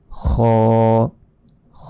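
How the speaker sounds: খ as a throaty fricative "khooo"; long Arabic খ, level and drawn out; one-second stretch. Shown as a demonstration. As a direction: scrape the kh and hold the rounded vowel. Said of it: "এক second টেনে পড়তে হবে".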